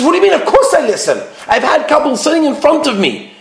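high-pitched defensive husband; mock-offended, pitched well up; acted indignation. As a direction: protest as if falsely accused. Husband indignantly denying wife's charge.

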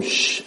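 soft imitated hush; hushing shh. Levium told the crowd to be quiet, hushing. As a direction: give a demonstrative shh. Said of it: "Told them".

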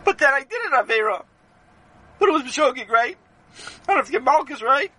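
high-pitched, whiny acted crying; crying protest. A narrator's reading. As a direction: plead like a boy in tears. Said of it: "Sammy began to cry".

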